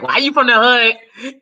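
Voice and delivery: imitating Monique roasting the crowd; mock Monique heckle. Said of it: "She's like".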